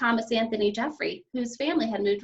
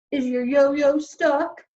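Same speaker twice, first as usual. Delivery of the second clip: slow, louder sneering question; mocking policeman; 5 semitones higher, 7 dB louder, much slower than usual. A narrator's reading. Policeman taunts her about a stuck yo-yo. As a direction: drag out the taunt slowly. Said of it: "the first policeman asked".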